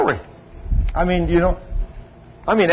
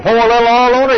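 retold bold command; 6 semitones higher, 5 dB louder, faster than usual. Retelling a bold healing act.

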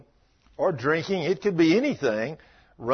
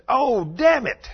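put-on boy cursing; 9 semitones higher, 5 dB louder, much slower than usual. Boy curses after stubbing toe.

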